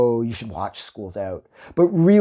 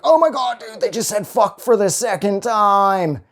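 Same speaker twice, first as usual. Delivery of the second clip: high, loud squeal of shock that fades; excited disbelief; 7 semitones higher, 4 dB louder than usual. Retold or imitated. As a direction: gasp it out, high and amazed. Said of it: "And I'm like".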